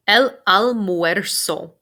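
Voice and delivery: said slowly and clearly for the class; drawn out so students can copy; careful teacher demo of El almuerzo; slow model pronunciation. Shown as a demonstration. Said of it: "It's a tricky word".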